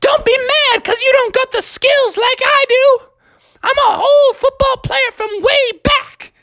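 very high-pitched granny trash talk; high, cocky old-lady voice; bragging grandma. Acted. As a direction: boast like an old-timer in a high cartoon voice. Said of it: "And Grandma was like".

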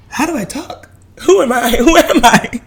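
playfully dramatic who am I; laughing through a mock crisis; mock existential crisis. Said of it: "and you were like".

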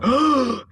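sharp, loud acted gasp; heart attack gasp. Co-host acts out a one-scene cameo death, mock gasp. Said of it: "having a heart attack".

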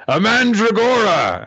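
a big, loud, high showman's reveal of the word; grand announcement. Acted. Teacher Lance unveiling the assignment creature to students, loud and triumphant. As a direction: present the creature like a stage magician. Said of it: "Lance says".

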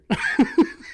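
airy, voiceless burst of laughter; silent laugh. Real, not acted. Host laughing at his own casting jab. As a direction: laugh with no voice at your own joke.

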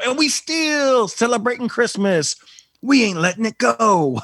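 high, slow drawled put-on voice; sassy put-on voice. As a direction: tease the lingering decorations. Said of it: "I'll go".

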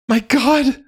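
very high-pitched scream of horror; panicked scream. A narrator's reading. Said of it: "Miguel screamed".

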